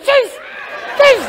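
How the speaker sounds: short falling "tchis" squirts; quick mouth-made squirt noises; water-gun squirt. Acted. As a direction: imitate a squirting water pistol.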